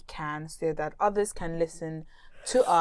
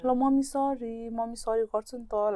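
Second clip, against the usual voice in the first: higher-pitched mock apology from mom; polite apology, imitated; 4 semitones higher, much faster than usual. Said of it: "like, you know, polite way ma".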